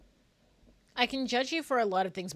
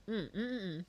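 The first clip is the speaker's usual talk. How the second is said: ordinary talk, nothing marked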